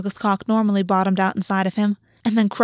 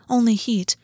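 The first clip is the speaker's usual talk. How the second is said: usual speaking voice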